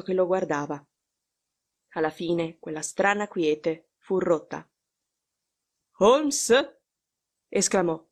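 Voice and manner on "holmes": higher rousing call, rising at the end; insistent. A narrator's reading. Visitor rousing the sick man from sleep, insistent. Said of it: "nel tono insistente con cui si risveglia".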